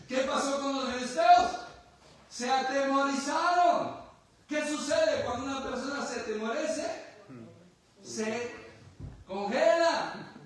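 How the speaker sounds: a preacher raising his voice over the fearful Philistines; preaching at full force. Acted. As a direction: drive the point home to the congregation. Preacher builds on fear.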